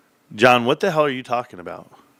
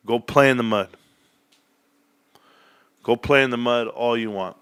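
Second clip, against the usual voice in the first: ordinary talk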